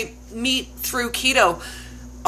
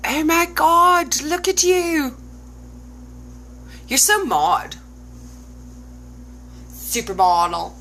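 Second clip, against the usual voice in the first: delighted gasp; 5 semitones higher than usual. Real, not acted. Gushing over an old photo.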